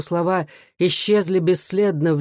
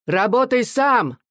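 higher, drawn-out heckle; lone distant voice; 7 semitones higher, slower than usual. A narrator's reading. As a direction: call it out slowly from the back of a crowd. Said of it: "издали раздался одинокий голос".

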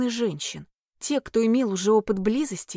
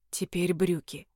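low, soft, rasping order; hoarse command; 4 semitones lower, 5 dB quieter than usual. A narrator's reading. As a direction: rasp the order quietly. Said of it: "хрипло велел".

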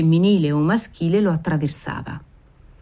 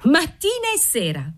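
put-on much higher, girlish call with contained volume; shrill echoed cry; 10 semitones higher than usual. A narrator's reading. Seamstress echoing the waiter, high restrained shriek. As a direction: pitch it right up, crying back in triumph. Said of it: "strillò Antonetta".

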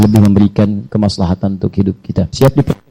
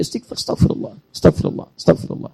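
whispered istighfar; 4 semitones higher than usual. Shown as a demonstration. Preacher showing how to repeat the istighfar, whispered. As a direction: breathe the words "astagfirullah" as a whisper. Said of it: "Hadirkan, hadirkan".

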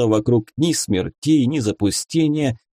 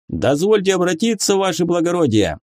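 high, eager military address; dutiful soldier, pitched well up; soldier reporting; 8 semitones higher than usual. A narrator's reading. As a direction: ask the officer's permission smartly. Soldier formally addresses his officer.

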